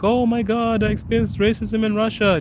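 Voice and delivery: high mock-dramatic complainer. Creator mimicking foreigners complaining about Russian racism, mock-shocked. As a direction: parody the shocked tourist, pitched high. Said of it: "people are like".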